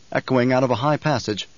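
plain reading voice

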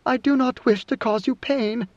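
understated anguish, as if the words are forced out; wrenched out. A narrator's reading. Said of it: "The words were wrenched from her".

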